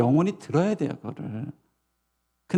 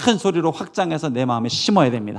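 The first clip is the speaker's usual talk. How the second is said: usual speaking voice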